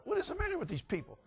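very high, soft incredulous squeak; exasperated thought. Retold or imitated. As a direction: marvel in a small high voice. Exasperated inward thought at friends.

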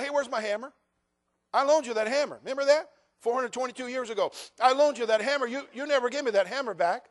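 hammed-up, aggrieved neighbor demanding his hammer; mock grudge. Mock grudge over a loaned hammer.

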